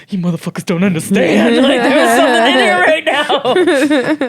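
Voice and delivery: loud, high, laughing half-shout at the pranksters; laughing mock scold. Telling off cousins who scared her, laughing loudly. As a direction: scold them loudly while breaking into laughter.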